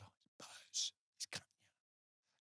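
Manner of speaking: hushed, whispered warning to a teammate; whispered aside. Retold or imitated. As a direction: whisper it as a private tip-off. Teammate's aside while passing phone.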